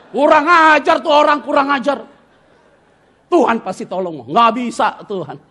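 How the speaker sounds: high, loud wailing protest; imitated crying outburst. Wife crying upstairs over the one who hurt them, imitated loud wail. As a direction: wail it loudly, acting out her anguish. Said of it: "Nangis di atas".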